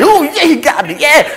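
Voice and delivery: high-pitched little cheerleader; imitated child's excited chatter; excited little girl. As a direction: squeal it like a proud little kid. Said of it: "but she's like".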